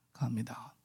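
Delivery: a hushed plea to calm down; whispered prayer. Retold or imitated. On the plane, begging the Lord in a whisper. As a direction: breathe it in a whisper.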